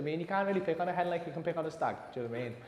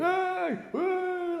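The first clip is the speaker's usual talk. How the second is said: high-pitched mock group whoop; rowdy cheer; 14 semitones higher than usual. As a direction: imitate a rowdy crowd cheering.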